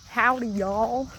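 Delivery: slow, drawn-out Southern "Howdy, y'all"; playful Southern howdy drawled out; Southern greeting. Shown as a demonstration. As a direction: ham up the Southern accent, slowly. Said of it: "that's my giveaway for being not local".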